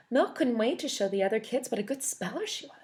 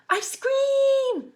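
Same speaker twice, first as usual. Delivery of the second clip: very high, even louder shout; louder yell; 10 dB louder than usual. Acted. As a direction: belt it out. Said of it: "yelled Waffle".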